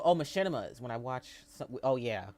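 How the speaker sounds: ordinary delivery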